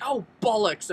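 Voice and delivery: high, loud mock curse; imitated exclamation. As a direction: burst out imitating a startled seatmate. Seatmate's exclamation as suitcase falls.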